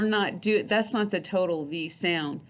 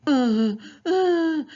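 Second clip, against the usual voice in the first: rising whiny uh, louder; 9 semitones higher, 5 dB louder than usual. Shown as a demonstration. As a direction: whine the uh like a kid. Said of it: "kinda like when somebody's whining".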